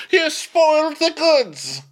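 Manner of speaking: high, loud, drawn-out cry; mock outrage. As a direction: yell it slowly in exaggerated indignation. Mock outraged reply to Daemon.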